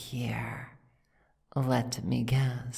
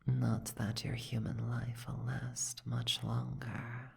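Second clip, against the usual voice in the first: much softer, almost to oneself; sinister aside; 8 dB quieter than usual. Acted. Dragon's aside about the captive's short life, softly ominous. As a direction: drop the volume for a veiled threat.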